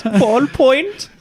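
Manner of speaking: high squeaky cartoon boy; Dutch Boy voice. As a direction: pitch way up, childlike. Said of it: "in the Dutch Boy voice".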